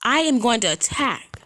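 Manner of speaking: a real heated warning; fired-up warning. Real, not acted. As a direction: warn them hard and loud. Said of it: "like I said".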